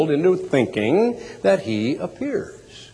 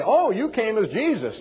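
lightly acted onlooker, pitched up; higher-pitched mock recognition; party guest's remark; 8 semitones higher than usual. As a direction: exclaim it in a higher voice, like another guest. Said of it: "if people say".

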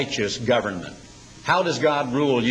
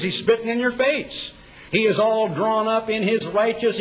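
higher, heated preaching; rising indignation; 8 semitones higher than usual. Acted. Preacher painting a ranting ruler for the congregation, heated. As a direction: build the outrage of the ranting ruler.

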